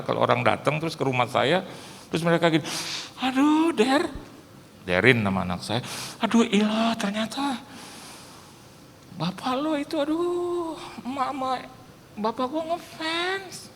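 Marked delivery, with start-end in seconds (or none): 3.2-4.1 high mock gushing aduh; gushing guest. 6.2-13.7 high, soft starstruck gushing; gushing young fan. Retold or imitated. Daughter's friend gushing.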